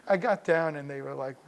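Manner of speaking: plain reading voice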